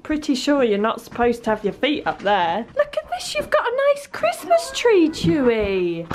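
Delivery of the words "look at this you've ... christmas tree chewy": high sweet pet voice; talking to dog. Real, not acted. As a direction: coo at the dog.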